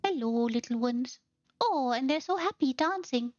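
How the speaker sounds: high, sweet cooing; cooing to creatures. Real, not acted. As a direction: talk to little critters like babies.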